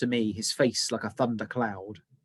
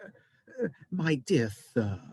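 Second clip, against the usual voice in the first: smooth oily purr of a fawning manager; purring Mister Mollusk; 5 semitones higher, slower than usual. A narrator's reading. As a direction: purr to soothe the giant. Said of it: "purrs the voice of Mister Mollusk".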